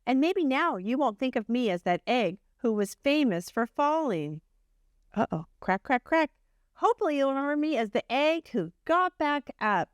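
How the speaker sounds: gentle storybook reading voice; reading to children. A narrator's reading. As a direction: tell it like a bedtime story to a class. Reads Humpty's story aloud.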